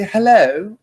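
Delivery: a plummy British "Hello," with a high rising lift; British accent. Shown as a demonstration. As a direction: say "Hello," like an English gent, lifting it up. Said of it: "with a British accent".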